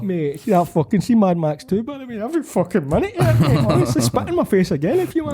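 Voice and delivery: high, gushing rave; excited. Real, not acted. Raving to mates about Mad Max, excited and high.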